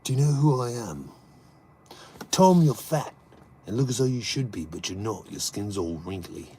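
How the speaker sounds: lightly voiced London tough guy menace; Jason Statham impression. Streamer doing a Statham movie line for chat, lightly voiced Statham impression. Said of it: "I love doing Jason Statham".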